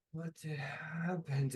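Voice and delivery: slow, soft bewilderment; dazed, hushed question. Acted. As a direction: draw it out, softly stunned. Revived character realises her body changed.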